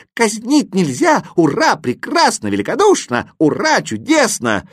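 high, loud cheering; jubilant, ringing high cries; overjoyed. A narrator's reading. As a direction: burst out in delighted exclamations. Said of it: "обрадовался восклицательный".

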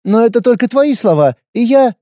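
wary fighter, blunt and guarded; young warrior woman. A narrator's reading. Lina cut off.

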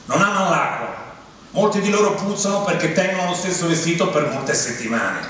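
ordinary talk, nothing marked

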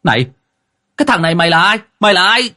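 put-on high, indignant young man's challenge; angry shout. A narrator's reading. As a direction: yell high at an intruder. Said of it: "quát lên".

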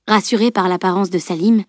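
usual speaking voice

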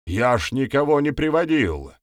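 high-pitched protest of innocence; wailing protest. A narrator's reading. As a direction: keep wailing that you are blameless. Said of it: "заголосил он".

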